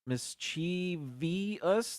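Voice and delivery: MISCHIEVOUS very slowly, mis-chee-vee-us; drawn-out mispronunciation. Shown as a demonstration. Host pointing out the false I, MISCHIEVOUS drawn out as mis-chee-vee-us. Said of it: "There's no I after the V".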